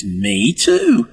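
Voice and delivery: high-pitched, delighted "me too" in a joke; amazed delight. Acted. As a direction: act out a pub drinker's happy surprise. Acting out joke's amazed drinker.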